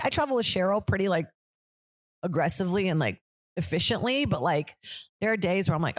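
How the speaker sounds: ordinary talk, nothing marked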